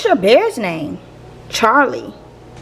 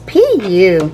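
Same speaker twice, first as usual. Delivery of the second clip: disgusted; 4 semitones lower than usual. Acted. Brussels sprouts smell like socks.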